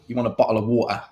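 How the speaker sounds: bottle of water in British accent, t dropped. Shown as a demonstration. Demo line for viewers, a plain British accent. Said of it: "words people get me to say in my British accent".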